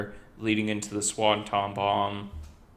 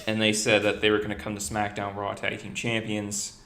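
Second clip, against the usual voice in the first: normal talking voice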